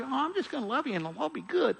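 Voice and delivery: high, soft mock-sweet voice; wishy-washy. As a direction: mimic a wishy-washy softie, high and light. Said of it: "It's not just wishy-washy".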